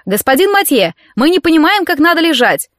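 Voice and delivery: high-pitched call from below. A narrator's reading. Dorian in the water calling up to trainer, high shout. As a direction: call up high, feigning confusion. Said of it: "прокричал наверх".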